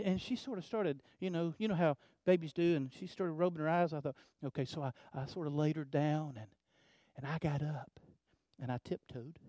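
soft, low storytelling hush; hushed retelling. Retold or imitated. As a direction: tell it quietly, as if creeping away from a sleeping baby. Baby dozing off, he creeps away.

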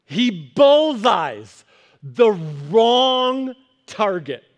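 slow, drawn-out and weighty; a slow sermon punchline. Acted. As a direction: stretch every word to land it. Dramatic slow punchline delivery.

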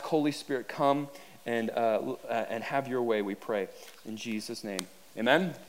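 soft, earnest prayer voice; hushed pulpit prayer. Acted. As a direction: pray it quietly before the congregation. Pastor leads a closing prayer.

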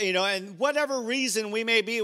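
normal talking voice

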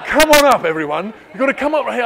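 loud cheerful call to the front. Real, not acted. Calling children to the front.

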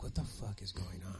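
low, hushed, baffled muttering; quiet bewilderment. Retold or imitated. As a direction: mutter it to yourself, puzzled. Retold baffled worry, under his breath.

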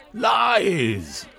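high furious shout; cried out. Acted. Lord Blackanor denying the charge to the club, a high angry shout. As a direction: hurl the denial at him. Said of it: "Lord Blackanor cried".

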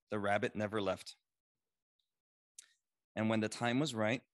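ordinary talk, nothing marked

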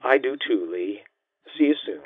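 gentle, hushed woman's voice; softened. A narrator's reading. Mother says goodbye to her ex-husband, softened. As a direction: let the voice sink softly, tenderly. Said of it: "Her voice softened".

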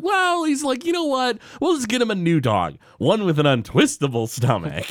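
lightly hammed Homer; imitating Homer. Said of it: "and Homer's like".

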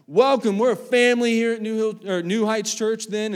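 loud, high church announcement voice; announcer welcome. Retold or imitated. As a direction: boom it out like a church greeter. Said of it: "have me do announce".

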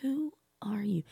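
breathless whispered disbelief; stunned whisper. Retold or imitated. Mother whispering her shock at her daughter. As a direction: hush it like you can't believe your eyes.

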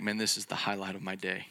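hushed, mildly delighted Jesus; contented sigh. Retold or imitated. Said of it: "and he's like".

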